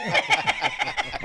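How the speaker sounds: a sudden high laugh breaking out; real high-pitched laugh; high burst of laughter. Real, not acted. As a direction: laugh out loud at the memory. Laughs at own memory.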